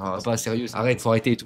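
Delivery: hushed plea to drop it, retold; embarrassed protest. Son begging dad to stop teasing, softly embarrassed.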